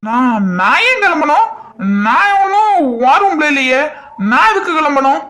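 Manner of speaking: high, mock-offended pleading; indignant protest. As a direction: protest being told to leave. Husband protests being sent away.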